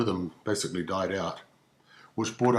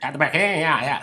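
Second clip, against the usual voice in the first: put-on higher, louder clipped chant of old slang; forties rhythm; 5 semitones higher, 4 dB louder, faster than usual. Comic tracing forties Kiwi speech, in a brisk chant. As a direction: rattle it off like a forties bloke. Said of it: "the rhythm of the language sort of went something like".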